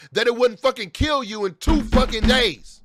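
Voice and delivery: loud and high, spitting each word out; shouting, raw with anger; furious shout. Real, not acted. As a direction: yell it in outrage. Furious rant about doctors.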